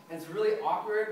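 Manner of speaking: ordinary delivery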